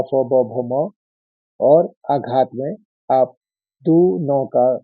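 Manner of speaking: usual speaking voice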